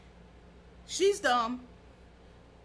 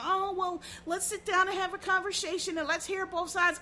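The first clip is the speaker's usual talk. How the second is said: pitched-up sneering mimic of reasonable talk; mock the both-sides crowd with a raised, prissy voice; mocking whiny quote; 6 semitones higher than usual. Comedian mocking millennials who want dialogue, in a sneering high voice.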